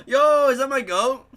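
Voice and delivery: loud gasping surprise; surprised shout. Real, not acted. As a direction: gasp and shout it out. Gasp of surprise at friend.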